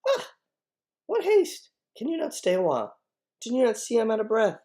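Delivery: high, loud protest at being rushed; winding her up. Acted. Nurse stalling Juliet on purpose, exaggerated protest. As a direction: make a showy fast complaint, pitched high. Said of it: "watch the nurse wind her up on purpose".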